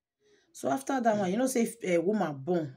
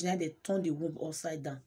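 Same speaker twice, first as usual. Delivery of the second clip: ordinary delivery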